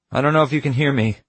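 high, fast call to the sky. Acted. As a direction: shout up to the stars. Calling to aliens in the sky.

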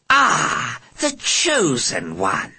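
slow, high rasping alien. Acted. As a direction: drawl it out like a sinister alien. Alien voiced in story.

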